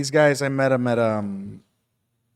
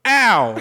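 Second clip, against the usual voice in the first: higher, louder fake yelp of pain; hammed-up pretend pain cry; mock yelp; 9 semitones higher, 10 dB louder than usual. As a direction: fake an "Ow!" from a spanking. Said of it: "I would be like".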